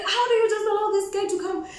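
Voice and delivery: high-pitched retold protest; indignant questioning. Woman questioning friend who allowed the guy, indignant questioning.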